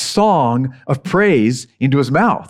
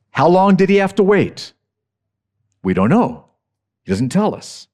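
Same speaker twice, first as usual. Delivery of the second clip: normal talking voice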